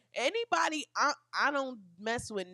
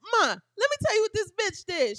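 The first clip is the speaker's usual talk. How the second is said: high mock complaint to mom; hammed-up tattling to mama; calling mom; 9 semitones higher than usual. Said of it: "I be like".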